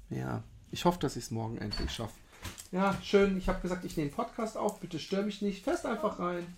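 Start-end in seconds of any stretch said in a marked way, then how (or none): 2.7-6.5 annoyed. Real, not acted. Annoyed at partner entering.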